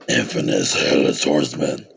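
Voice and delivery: deep, hushed spooky voice; low, creepy horror-host croak; scary voice. Acted. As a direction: sink low and ominous to name a monster. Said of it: "that scary voice".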